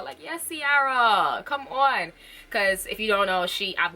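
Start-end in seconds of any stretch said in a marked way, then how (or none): 0.2-2.1 slow drawn-out cheer. Retold or imitated. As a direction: hype her up. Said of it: "I literally tweeted her".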